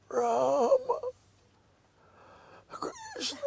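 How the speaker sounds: high, strangled call of the names; strained dying cry. Retold or imitated. Dying father straining to call his sons. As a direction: reach for them with your last breath.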